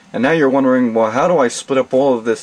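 ordinary talk, nothing marked

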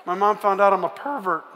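ordinary delivery

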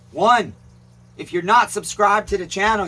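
normal talking voice

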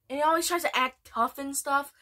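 ordinary delivery

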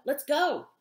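loud urgent yell. Acted. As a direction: hurry them with a shout. Stranger yelling at driver.

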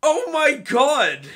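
loud, drawn-out gasp of shock; high and loud, stretched out. Real, not acted. Sudden shock at game.

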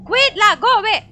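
terrified shout, high and loud; panicked yelling. Real, not acted. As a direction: scream at it to leave.

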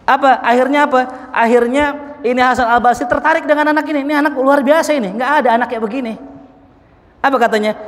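high, loud, quickening praise; rising excitement. Real, not acted. Preacher praising the remarkable boy to congregation, excited and fast. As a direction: build to an eager climax.

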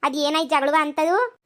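ordinary delivery

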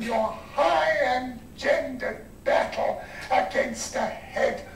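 slow, grand theatrical recitation of Lear; old-fashioned Shakespearean declaiming. Shown as a demonstration. Lecturer demonstrates old-fashioned acting, grandly declaiming. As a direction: storm at the heavens like an old-school stage king. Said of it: "I was holding the voice like that".

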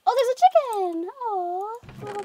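loud excited squeal. Real, not acted. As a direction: burst out with delight. Excited at chicken.

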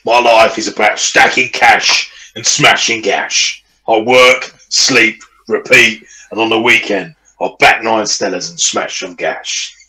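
slow, hammed-up lad voice; put-on bloke voice. Bragging about work and weekends, put-on bloke voice. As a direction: play the hard-living working bloke. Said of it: "blokes that sound like that".

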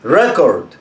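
ريكورد with the stress on كورد; stress on second syllable. Shown as a demonstration. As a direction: land on كورد, barely voice ري. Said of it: "لو اتكيت على الحرف التاني".

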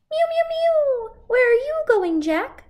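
very high squeaky kitty voice; cat voice. Acted. Cat character speaking.

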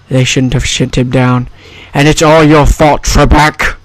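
slushy Scottish impression with s turned to sh; mock-indignant Scottish movie star; Sean Connery. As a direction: slur every s like an old film star. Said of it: "I can also do a Sean Connery impression".

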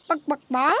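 lightly imitated clucking chicken; short clucks with a lift at the end; animal call imitation. Animal sounds after matching game.

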